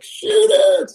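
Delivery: very high-pitched mock yell; retold frantic urging. Hunter without a gun urges buddies, acted frantic yell. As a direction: squeal it out as an urgent command.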